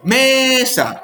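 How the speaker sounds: "mesa" with ME high and drawn out; exaggerated stressed syllable. Imitating children finding the accent, hugely exaggerated ME in "mesa". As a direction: stretch ME in "mesa" like a class of six-year-olds. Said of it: "exagerándolo todo muchísimo".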